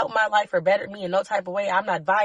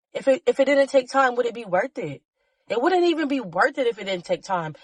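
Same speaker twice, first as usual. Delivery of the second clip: usual speaking voice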